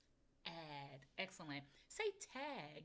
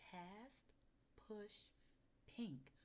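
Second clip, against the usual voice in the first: much softer echo of the words; 7 dB quieter, much slower than usual. Shown as a demonstration. Teacher quietly echoing words for the child. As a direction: whisper along with the child. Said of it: "You say it".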